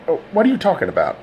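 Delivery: fast, pushy comeback; demanding. A narrator's reading. Todd pushing back at the detective, demanding. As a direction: throw the question back hard. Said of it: "demanded Todd".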